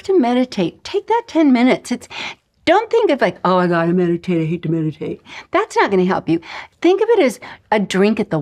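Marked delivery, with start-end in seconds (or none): 3.4-5.2 low, weary mock grumble; grudging whine. Teacher shows how not to think of meditation, grudging. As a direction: drag it out like an unwanted chore, mock reluctant. Acting reluctant meditator, as warning.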